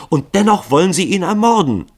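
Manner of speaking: very high, loud protest; appalled accusation. A narrator's reading. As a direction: challenge them in an outraged cry. Daughter challenges her father's would-be killers.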